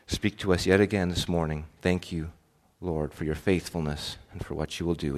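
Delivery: low, softened praying voice; hushed, reverent congregational prayer. Real, not acted. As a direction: pray quietly and evenly, pitch settled low.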